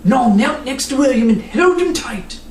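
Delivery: ordinary delivery, nothing marked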